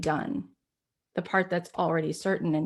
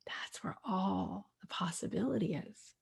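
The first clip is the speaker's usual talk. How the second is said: soft unvoiced whisper, much softer; 10 dB quieter than usual. Real, not acted. Telling listeners where possibility lies, in a whisper. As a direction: drop to a secret hush. Reflective talk, sudden hushed line.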